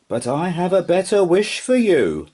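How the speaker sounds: high, gentle magical voice; kind unicorn voice. Acted. Unicorn comforting the sad horse, high and gentle. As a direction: offer it kindly, high.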